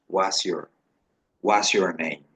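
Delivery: "What's your name?" run together fast as "wasjor"; rapid spoken model; quick contracted form. Shown as a demonstration. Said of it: "de manera veloz se puede decir".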